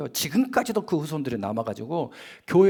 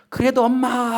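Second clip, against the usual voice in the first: lightly voiced child calling mom, higher and louder; child running to mom; 8 semitones higher, 6 dB louder, slower than usual. Preacher playing a child running into mother's arms, calling out. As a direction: call out like a little kid running to mother. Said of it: "하고 뛰어들어요".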